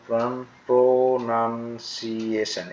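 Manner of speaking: teacher's stretched, split-up প্রোনাউন্সিয়েশন; syllable by syllable. Shown as a demonstration. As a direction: sound out প্রোনাউন্সিয়েশন in long, separate syllables. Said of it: "এভাবে উচ্চারণ করবে".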